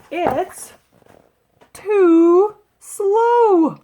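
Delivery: high, loud, drawn-out punchline; pause, then wail each word separately; emphatic complaint. A narrator's reading. Loretta explains what's wrong with the wheelchair, high emphatic complaint.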